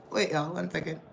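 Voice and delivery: ordinary delivery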